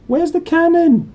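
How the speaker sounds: very high, loud dopey squawk; a dim boy jolted awake, blurting; stupidly. Acted. Dudley jerked awake, asking stupidly about the cannon. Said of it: "he said stupidly".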